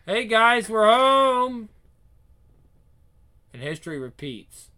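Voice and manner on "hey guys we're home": loud, high call into the house. Acted. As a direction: announce it to the whole house. Parents arriving, calling out.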